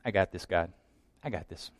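low dismissive confidence; smug self-reliant. Acted. Smug self-reliance before God.

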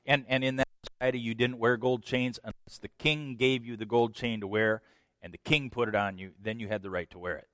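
ordinary delivery

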